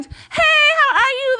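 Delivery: mock the two-faced cheerful hello; fake sweet greeting. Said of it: "they talk to you like".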